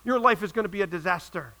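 high, driving, insistent sermon voice; urgent pulpit exhortation; full-force preaching. Real, not acted. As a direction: preach it hard, pitched well above normal talk.

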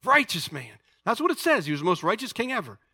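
usual speaking voice

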